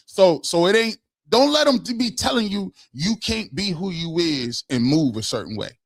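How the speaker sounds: ordinary delivery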